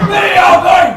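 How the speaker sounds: high, loud exasperated shout. Acted. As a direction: call after people walking out. Inspector as everyone walks off.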